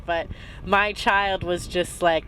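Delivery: normal talking voice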